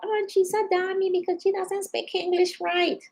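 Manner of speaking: high, condescending mock voice, pitched well up; mocking people. Imitating casino coworkers who thought her dumb, high mock voice. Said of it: "people thought".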